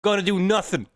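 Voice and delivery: a quick, cheeky stab at an Irish brogue; self-admittedly bad Irish accent impression; Conor McGregor Irish accent. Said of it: "as Conor McGregor would say".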